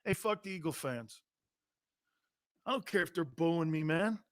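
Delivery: very soft, offhand athlete impression; Baker Mayfield. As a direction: shrug off the booing under your breath. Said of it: "Can you imagine Baker Mayfield in Philly doing this?".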